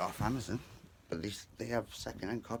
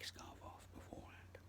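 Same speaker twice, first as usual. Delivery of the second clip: whispering; 18 dB quieter than usual. Retold or imitated. Whispered thought quote.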